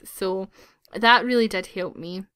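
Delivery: usual speaking voice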